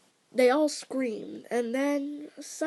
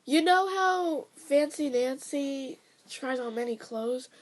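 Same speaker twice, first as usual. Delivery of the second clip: ordinary delivery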